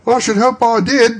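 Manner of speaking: put-on high, loud boy's voice; a surprised urchin; startled young lad; street boy. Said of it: "replied the lad".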